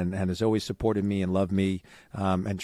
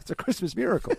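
high, gushing mock cheer; mock excitement; 10 semitones higher, faster than usual. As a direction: ham up the holiday joy. Jokey cheer near list end.

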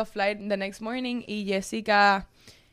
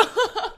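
high laugh; 12 semitones higher than usual. Real, not acted. Laughing mid-retelling.

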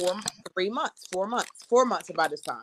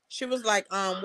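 ordinary delivery

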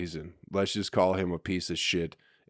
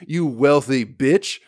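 higher, mocking put-on voice, slowing on the last words; mock indignant retort; 6 semitones higher, 6 dB louder than usual. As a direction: sneer it like a pretend brat talking back. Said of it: "I wasn't like".

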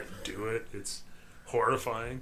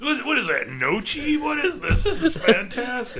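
high, loud gushing over food; mock delight; 13 semitones higher, 6 dB louder than usual. Co-host playing a diner thrilled by the mystery chunks, mock delight. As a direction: squeal with mock foodie enthusiasm.

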